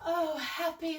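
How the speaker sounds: usual speaking voice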